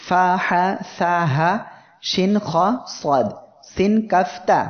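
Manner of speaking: each letter drawn out slowly; slow recitation. Shown as a demonstration. Teacher reciting letters slowly to the class. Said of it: "অক্ষরগুলি আবার একটু খেয়াল রাখুন".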